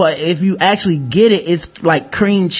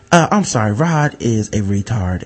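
low smug manager; 8 semitones lower, 4 dB quieter than usual. Retold or imitated. Manager excusing the new cook, low and hushed. Manager voiced in retold story.

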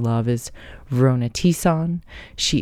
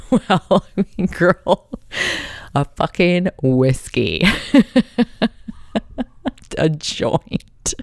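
joking through laughter; laughing; 5 semitones higher, 4 dB louder, slower than usual. Real, not acted. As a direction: laugh while answering. Laughing at anxiety question.

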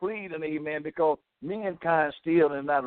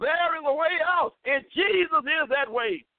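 much higher, ringing preacher cry; fervent climax; 11 semitones higher than usual. Real, not acted. Radio preacher promising sinners a way out, soaring high. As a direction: lift it up high as the sermon peaks.

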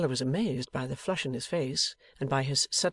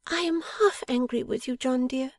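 high-pitched playful scolding; half-angry, amused wife; 10 semitones higher than usual. A narrator's reading. Wife mock-scolds her husband, playful. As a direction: scold fondly, pitched well up. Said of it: "laughing a little".

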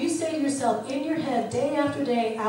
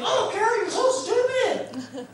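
much higher, slow, laughing scold; mock your own clumsiness; a hammed-up self-scold over a typo; self-scolding outburst; 10 semitones higher, slower than usual. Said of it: "my mouth would say".

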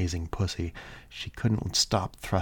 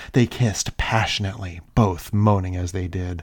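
ordinary delivery

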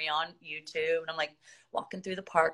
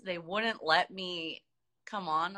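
usual speaking voice

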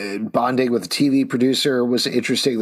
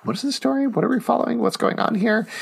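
confused reader; 11 semitones higher, faster than usual. Retold or imitated.

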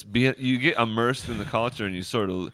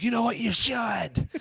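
mock voter; 12 semitones higher than usual. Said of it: "maybe people be like".

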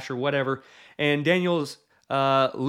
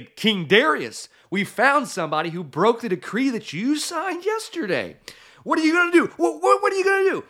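urgent, high, loud demand, climbing into a squeal; frantic high-pitched tattletale; agitated accusers; 11 semitones higher, 3 dB louder than usual. Acted. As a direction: press the king, pitch shooting up. Governors urgently accusing before king.

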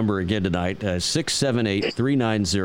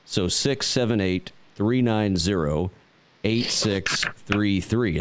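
slow number readout; 2 semitones lower, slower than usual. Shown as a demonstration. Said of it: "reads out the phone number".

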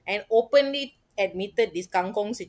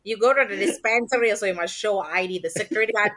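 ordinary talk, nothing marked

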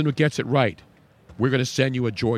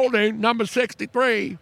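put-on higher, Southern-accented NFL referee announcing a call; 8 semitones higher than usual. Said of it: "They all sound Southern".

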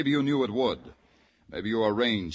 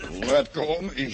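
higher, tense and pained; strained cry; 8 semitones higher than usual. Acted. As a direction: force it out while being held. Held in judo grip.

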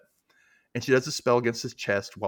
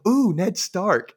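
gasping, higher, drawn-out ooh of delight; gasp, then a louder, slower mock thrill; mock-excited; 9 semitones higher, 5 dB louder, much slower than usual. Recapper acts out his reaction to the name, mock-excited.

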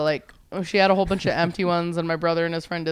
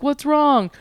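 alarmed roommate; 9 semitones higher, 5 dB louder than usual. Retold or imitated.

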